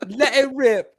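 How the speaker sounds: high, hammed-up Cockney-style British accent; bad British accent. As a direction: play a cartoonish Brit, way up in pitch. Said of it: "a bad accent from a different country".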